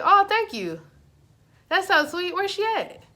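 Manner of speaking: high, loud, sweet gushing; gushing thanks. Retold or imitated. Thanking brother for relayed flowers, high gushing delight.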